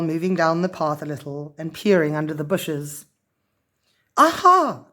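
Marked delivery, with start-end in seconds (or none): 4.2-4.9 high, louder triumphant aha; excited cry. A narrator's reading. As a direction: cry out at the find. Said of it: "cried Lavernia".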